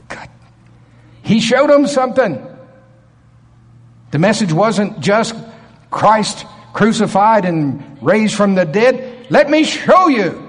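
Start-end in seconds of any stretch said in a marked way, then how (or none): 1.2-10.4 raised, high-pitched preacher's proclamation; impassioned preaching. Real, not acted. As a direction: proclaim it forcefully to the congregation. Preacher building to a climax.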